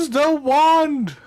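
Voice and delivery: high, slow shouted order; drawn-out yell. Acted. Game master as Scar calling down to the party, drawn-out yell. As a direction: holler it down, stretching each word. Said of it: "and he screams".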